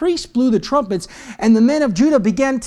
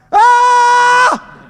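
long high loud yell; battle cry; 13 semitones higher, 7 dB louder than usual. Acted. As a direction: let out a sustained shout. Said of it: "they said".

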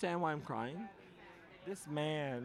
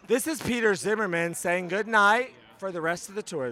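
announcing; 3 semitones higher, 7 dB louder than usual. Real, not acted. Announcing a sign-off to the crowd.

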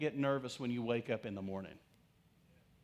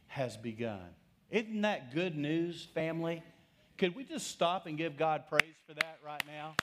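usual speaking voice

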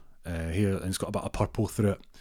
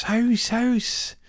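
put-on high old lady calling out, much higher; shouting house; 4 dB louder than usual. Gran's high call to the bingo caller. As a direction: gran calls out her win across the hall. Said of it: "Tony's gran goes".

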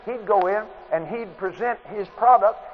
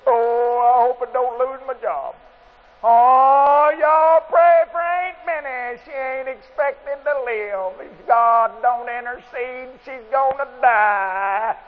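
hammed-up frantic worrier, higher, louder and slow; mock panic; 3 semitones higher, 4 dB louder, slower than usual. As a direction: imitate a fretful churchgoer begging for prayer, building to a shout. Mocking fearful prayer request.

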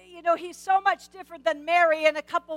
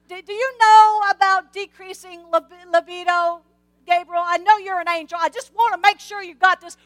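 a hammed-up old man explaining menopause to Gabriel; cheeky challenge; 2 semitones higher, 7 dB louder than usual. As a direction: needle the angel like you know better. Zacharias lectures Gabriel on menopause.

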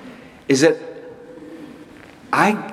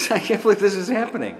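fast, higher, laughing through the words; laughing in disbelief; 9 semitones higher, faster than usual. Retold or imitated. Pastor recalls his disbelief while pinched, laughing. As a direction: giggle it out, amazed. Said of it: "I started to laugh".

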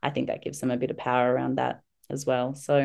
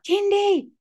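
put-on very high, loud kid shout; imitated child's scream; 5 dB louder than usual. Daughter casting a spell on mum, high screamed call. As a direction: scream out the magic word as a child. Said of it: "she'll scream out".

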